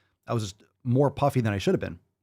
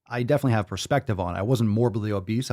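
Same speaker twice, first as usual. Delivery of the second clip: ordinary delivery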